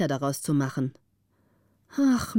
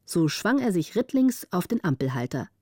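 ordinary delivery, nothing marked

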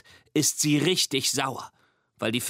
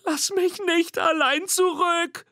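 high, slow squeaky plea; a tiny monster begging not to be left; pleading monster call; 3 dB louder than usual. A narrator's reading. Mimi calls after Nina, high pleading squeak. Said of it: "ruft Mimi".

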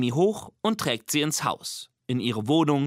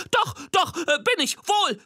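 high-pitched, insistent monster squeak; squeaky little monster; 3 dB louder than usual. A narrator's reading. Little monster contradicting the disappointed girl, squeaky and insistent. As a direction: protest in a tiny monster's piping voice. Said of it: "ängstlichen Monster mit Piepsstimme".

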